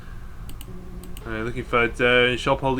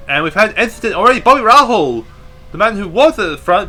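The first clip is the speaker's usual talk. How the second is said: sudden rise, louder and higher; excited commentary; 5 semitones higher, 7 dB louder than usual. Real, not acted. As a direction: call the crash with alarm. Sudden crash in race commentary.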